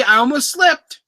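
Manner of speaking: high, excited near-slip; caught myself. Real, not acted. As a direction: blurt it out, high and amused. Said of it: "Oh, I almost said it".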